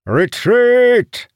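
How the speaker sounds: high, loud, drawn-out shout; shouted command. A narrator's reading. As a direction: yell the order to fall back. Commander orders troops to flee.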